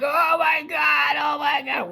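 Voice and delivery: high, slow anguished cry; retold screaming. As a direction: plead in pain and panic. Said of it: "He's just there screaming".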